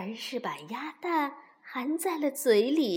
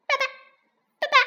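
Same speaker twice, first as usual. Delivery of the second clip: tiny, high, chirping voice; duckling calling daddy; 12 semitones higher, 5 dB louder, much faster than usual. Acted. Newborn duckling calls to the fox, high eager chirping. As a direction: call out like an eager baby duck.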